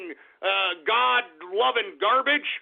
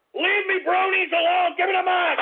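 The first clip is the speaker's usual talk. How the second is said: higher-pitched, raised shout; angry shout; 8 semitones higher, 3 dB louder than usual. Acted. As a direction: demand it, fuming. Host enraged at Alex Jones.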